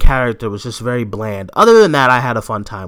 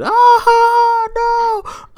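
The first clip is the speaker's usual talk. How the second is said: high, drawn-out "aah, no"; mock wail; 4 dB louder than usual. Co-host reacts to friend missing the movie, mock wail. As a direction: wail in exaggerated dismay.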